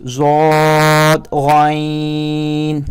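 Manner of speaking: ضاد غین drawn out very slowly; recited letters. Shown as a demonstration. Teacher reciting confusing letters. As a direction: stretch ضاد and غین heavily. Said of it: "یہاں پر جو confuse ہوتے ہیں".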